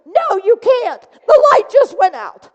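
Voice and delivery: high, loud distressed protest; upset outburst. Retold or imitated. Woman telling the student the light went out, high and loud. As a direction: blurt it out near tears.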